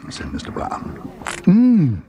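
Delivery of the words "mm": long, appreciative hums into slowed words; drawn-out bear's relish at the thought of buns; relishing food. Acted. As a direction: savour each mm with delight. Bear delighted at food.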